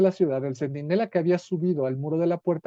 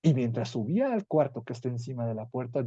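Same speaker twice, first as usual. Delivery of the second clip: ordinary talk, nothing marked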